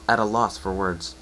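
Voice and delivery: ordinary delivery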